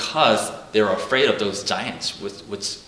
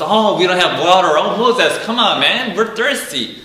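higher, louder whiny voice of thirsty people; complaining Israelites; 8 semitones higher, 6 dB louder than usual. Retold or imitated. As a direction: whine at Moses like a thirsty crowd. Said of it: "they're always complaining to God".

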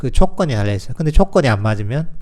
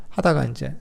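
usual speaking voice

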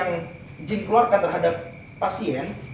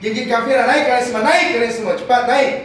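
raised, louder exorcist's orders; forceful command; 6 semitones higher, 6 dB louder than usual. Real, not acted. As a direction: order the jinn up urgently and forcefully. Commanding jinn during ruqyah. (perintah lantang dan mendesak)

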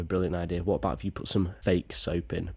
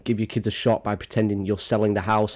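ordinary talk, nothing marked

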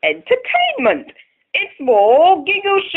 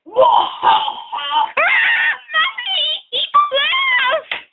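laughing shriek; 14 semitones higher than usual. Pretend. Giggle Show evil laugh.

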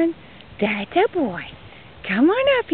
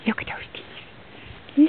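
whisper; 2 semitones higher, 10 dB quieter, slower than usual. Real, not acted. Talking to animals, repeats louder.

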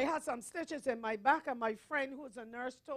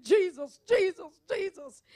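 retold desperate calling; 6 semitones higher, 13 dB louder, slower than usual. Preacher reenacting calls to Jesus in hospital, desperate calling. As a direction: mock-desperate cries of the name. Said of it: "I'm calling his name".

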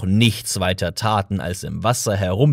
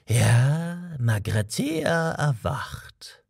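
slow, hushed whisper; 3 semitones higher, much slower than usual. A narrator's reading. Old man revealing to Arthur that Magrathea wakes, slow whisper. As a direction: breathe the name like a secret.

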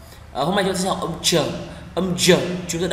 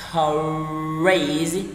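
usual speaking voice

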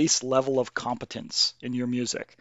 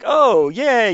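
high, louder mock cheer; mock excitement; 9 semitones higher, 6 dB louder than usual. Game fans following influencers, mock excitement. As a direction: cheer like an easily won-over fan.

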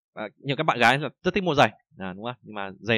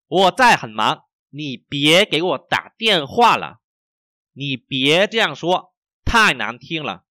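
slow, syllable-by-syllable model reading; slow, carefully enunciated Mandarin for learners; 4 semitones higher, 5 dB louder, much slower than usual. Shown as a demonstration. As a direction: read slowly so each tone is heard. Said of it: "mình đọc rất là chậm".

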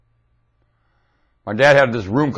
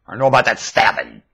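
ordinary talk

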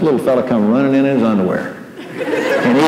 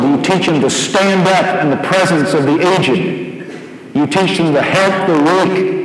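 fervent preaching; 7 semitones higher than usual. Acted. Pastor exhorts parents from pulpit.

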